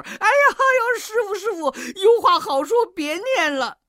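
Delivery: very high, loud wailing plea; pained begging. A narrator's reading. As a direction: cry out in agony for mercy. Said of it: "疼得悟空满地打滚儿". (疼得尖声哀叫求饶)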